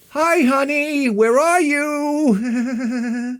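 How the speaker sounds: high singsong honey voice, nervous giggle; forced sweetness. Retold or imitated. Husband phoning missing wife, forced sweetness. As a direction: coo it, drawn out, with a fake laugh. Second call, forced niceness.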